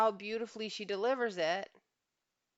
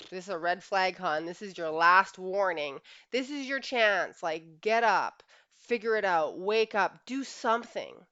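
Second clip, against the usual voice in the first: louder, slower, pleading ultimatum; urgent last warning; 2 semitones higher, 8 dB louder, slower than usual. Retold or imitated. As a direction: hammer each demand as a final warning, slow and loud. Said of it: "to a woman is like".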